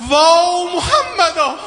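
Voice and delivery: high, slow wailing call; anguished cry. Retold or imitated. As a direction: cry out to the Prophet in grief. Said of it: "صدا زد".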